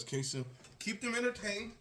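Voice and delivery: calling from another room; distant voice from offscreen; far off. Real, not acted. Went off to get water.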